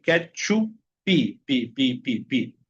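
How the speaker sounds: ketchup broken up, its last syllable "pi" repeated; slow ketchup, drilling the added "pi" ending; added final I. Shown as a demonstration. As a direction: sound out ketchup, then tap out pi, pi, pi. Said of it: "agregarle una I a la sílaba".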